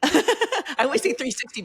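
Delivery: laughing at her own three-sixty slip. Real, not acted. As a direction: laugh at your own mix-up.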